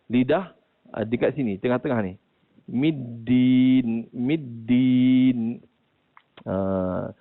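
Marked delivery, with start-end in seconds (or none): 2.7-5.6 "Middin" with the tongue tip on the gum ridge; correct dal demo. Shown as a demonstration. Teacher repeating the example word for students. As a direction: recite "middin" crisply. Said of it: "tempat makhraj huruf dal ada pada".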